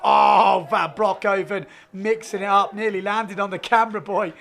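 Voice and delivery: loud, excited commentary on the action; live sports play-by-play. Real, not acted. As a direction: call the run as it happens, punching the big moments.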